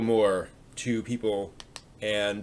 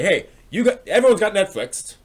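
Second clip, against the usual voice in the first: higher, louder friendly pitch; upbeat pitch; 8 semitones higher, 7 dB louder than usual. Pretend. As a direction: sell it like it's no big deal. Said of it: "start and say".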